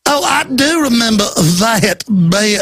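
slow affected Georgia drawl; mock a put-on Georgia accent, drawn out; slow, slightly high fake Georgia accent. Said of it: "This affected fake Georgia accent".

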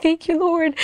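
high, mildly tearful imitation; retold tearful thanks. Imitating grandma crying behind them at the altar, high tearful thanks. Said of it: "like, crying behind me".

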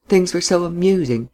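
plain reading voice

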